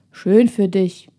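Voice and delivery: mildly gruff, dismissive reply; touch of surly growl; growled retort. A narrator's reading. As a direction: brush her off with a grumpy growl. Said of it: "knurrte der Junge".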